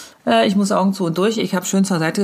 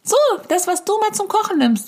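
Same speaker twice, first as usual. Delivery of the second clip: put-on much higher child's voice calling; loud child; 10 semitones higher than usual. Child telling its mother loudly and in a high voice. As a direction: shout it like a tattling kid. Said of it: "in siebzehntausend Dezibel".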